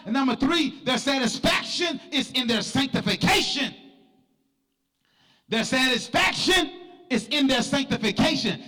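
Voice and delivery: high, driving church sermon delivery; full-force preaching. Real, not acted. Preacher exhorting the church to be different, full-force preaching. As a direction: preach it hard, stretching the phrase endings.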